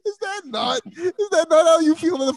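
high, tearful laugh in the voice. Real, not acted. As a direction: crack up mid-question. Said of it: "I'm tearing up".

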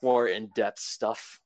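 normal talking voice

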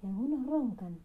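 ordinary delivery

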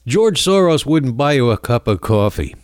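ordinary talk, nothing marked

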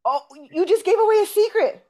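high, loud teasing gasp; playful gasp. Real, not acted. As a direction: tease him about spilling a secret. Host teasing about a secret.

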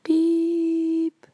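high, held beep tone; voicing the answering-machine tone before leaving the message; mock voicemail beep.

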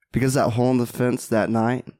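usual speaking voice

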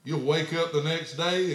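ordinary delivery

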